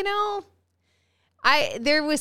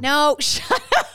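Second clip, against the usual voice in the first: laughing; 6 semitones higher, 5 dB louder than usual. Real, not acted. Laughing rejection of joke answer.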